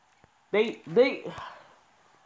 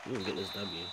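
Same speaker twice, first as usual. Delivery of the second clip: low determined mutter; 8 semitones lower, 11 dB quieter, faster than usual. Real, not acted. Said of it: "I ain't even getting excited".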